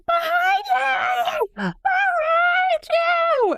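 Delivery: very high, drawn-out call of alarm; mock warning cry. Friend warns the film character of the killer, mock alarm.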